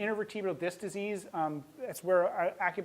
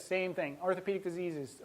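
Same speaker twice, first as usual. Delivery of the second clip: ordinary delivery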